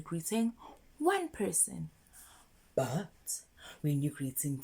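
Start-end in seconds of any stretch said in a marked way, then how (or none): 2.7-4.6 whisper. Shown as a demonstration. Teacher drops voice for emphasis.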